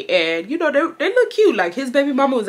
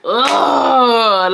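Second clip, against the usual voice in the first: long, loud, higher-pitched "ugh"; exasperated groan; 4 semitones higher, 5 dB louder than usual. Retold or imitated. Dismayed at spotting him.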